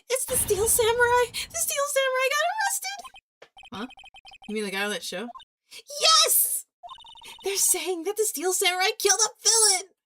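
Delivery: frantic assistant calling her boss with bad news; panicked girl. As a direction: blurt it out in a panic. Maya panicking on phone.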